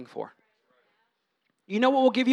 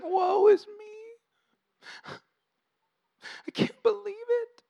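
whiny, much higher mock self-pity with a fake sob; sulky high voice; 13 semitones higher, 5 dB quieter, slower than usual. Preacher mocking sulking Christians, high mock crying whine. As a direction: wail like a sulking martyr. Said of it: "speaking in, like, a high-pitched voice".

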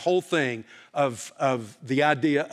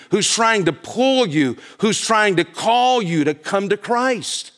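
fiery preaching; 5 dB louder than usual. Acted. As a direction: preach it out to the whole congregation. Full-force revival preaching.

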